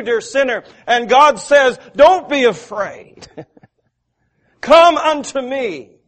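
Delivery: loud, high, full-force preaching; rising preacher's cadence. Acted. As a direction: drive it home from the pulpit. Climactic preaching.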